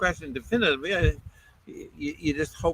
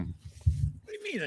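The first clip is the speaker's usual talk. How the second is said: surprised; 8 dB quieter, slower than usual. Real, not acted. Eating-cam host teased about food.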